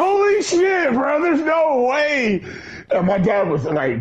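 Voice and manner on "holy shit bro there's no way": high, slow stunned exclaim; retelling his own shocked reaction; shocked disbelief. Retold or imitated. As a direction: gasp it out in disbelief.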